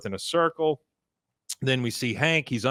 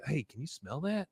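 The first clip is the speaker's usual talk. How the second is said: hushed cult member; 3 semitones higher, 13 dB quieter than usual. Retold or imitated. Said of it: "is like".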